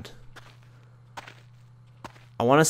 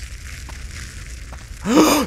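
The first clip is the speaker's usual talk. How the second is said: sharp scared gasp. Real, not acted. Jumpscare, donkey found dead.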